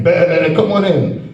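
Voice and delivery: a lightly bleated bah, then welcoming; sheep bleat. Retold or imitated. Preacher mocking his people as sheep, light bleat. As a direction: bleat like a naive sheep waving them in. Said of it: "We sheep".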